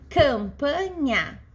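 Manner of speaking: "campanha" drawn out slowly, syllable after syllable. Shown as a demonstration. Teacher giving class an example of three syllables, syllable by syllable. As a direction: break "campanha" into slow, separate syllables.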